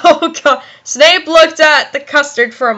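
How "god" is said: plain reading voice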